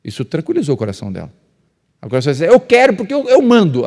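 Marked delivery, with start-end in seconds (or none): 2.4-3.9 high, loud bossy yell; hammed-up shout of the bossy husband; mock shouting man. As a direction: bark the order like a tyrant husband. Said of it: "quando o homem grita".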